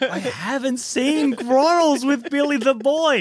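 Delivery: slow, high, laughing disbelief; high incredulous self. Retold or imitated. Retelling her reply to the customer, slow, high and laughing. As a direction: draw it out high and slow, cracking up. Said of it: "I'm like".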